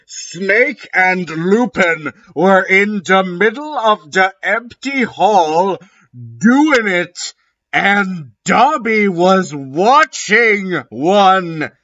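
high, hushed-up shocked narration. A narrator's reading. Narrator reacting in shock at the hall. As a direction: gasp it out, stunned.

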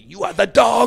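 high, loud shout imitating an angry chef; imitated yell. Chef berating the young cook, imitated high-pitched yell. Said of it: "would yell at me".

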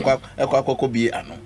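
usual speaking voice

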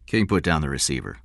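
ordinary delivery

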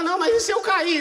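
high, loud mock fearful whine; wife afraid of falling, mock whining; retold fear. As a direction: whine it half laughing. Wife fearing a fall.

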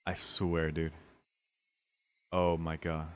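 ordinary delivery

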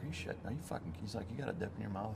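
quietly confronting him; a hushed mock interrogation; hushed instructor. Instructor catching him dipping.

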